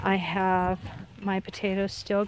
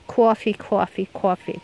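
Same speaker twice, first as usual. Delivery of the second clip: imitating a friend's New York "coffee" warm-up drill; New York accent. Gardener mimics friend's accent practice, mild New York accent. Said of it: "It was just in New York".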